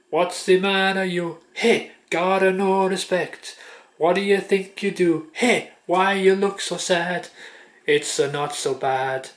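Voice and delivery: mock Italian, slow and bouncy like the novelty song; Italian accent. Vlogger singing a bit of Shut Up Your Face in mock Italian accent. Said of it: "I'll do it in the Italian accent too".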